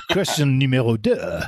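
put-on playful French-accented quiz host; mock-French announcement. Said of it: "do this in a French accent".

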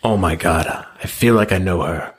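ordinary talk

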